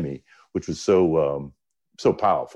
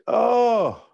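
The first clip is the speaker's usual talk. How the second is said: high, drawn-out genteel greeting; effusive mock welcome; Southern hospitality; 12 semitones higher than usual. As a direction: imitate a gushing Southern host. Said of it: "with that Southern hospitality".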